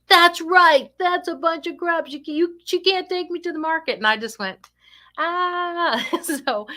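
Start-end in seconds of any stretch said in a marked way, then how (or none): 0.1-4.0 high-pitched indignant outburst, loud at first; mad client. Retold or imitated. Upset client backing up her complaint about the caregiver, mock angry. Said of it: "Because you're so mad". 5.2-6.1 long, high exasperated groan. Retold or imitated. Speaker hearing the client get mad again, exasperated cry.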